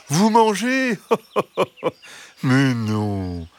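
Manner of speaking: high fox voice with a laugh; amused fox. Acted. Fox denying he eats hens, high and amused. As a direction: laugh off the idea sweetly.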